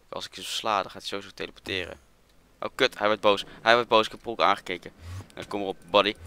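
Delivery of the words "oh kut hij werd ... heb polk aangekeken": loud, alarmed outburst. Real, not acted. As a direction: blurt it out loudly in alarm. Enderman turns angry on player. (luid en geschrokken)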